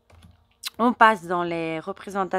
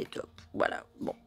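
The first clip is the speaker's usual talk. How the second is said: whispered aside; 9 dB quieter than usual. Real, not acted. Aside while showing the cards.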